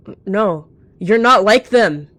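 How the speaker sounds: stammer, then rise loud and slow; desperate denial. A narrator's reading. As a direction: refuse to believe it, voice climbing. Rejected villain in denial.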